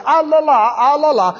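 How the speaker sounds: high wailing ah la la, fading a little; mourners yelling. Retold or imitated. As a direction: cry it out like hired mourners round the house. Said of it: "they run around the house yelling".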